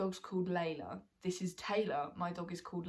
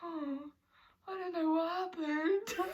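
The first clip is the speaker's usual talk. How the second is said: high, slow, dazed voice through laughter; dazed Taylor, laughing; 9 semitones higher, slower than usual. Retold or imitated. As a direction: play it dazed and confused.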